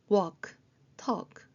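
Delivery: Walk, talk with no L sound; silent L. Shown as a demonstration. Teacher showing learners Walk, talk with silent L. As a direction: say Walk, talk as "wawk, tawk". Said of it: "그렇게 발음하시면 되고요".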